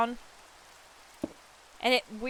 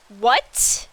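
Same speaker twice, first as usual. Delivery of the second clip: retold shocked what; 7 dB louder than usual. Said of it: "And I just went".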